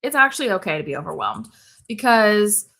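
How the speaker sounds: normal talking voice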